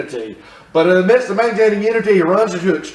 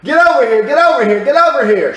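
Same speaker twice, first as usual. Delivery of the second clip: louder, higher calls to come over; summoning call; 6 semitones higher, 5 dB louder than usual. Acted. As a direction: call people over urgently.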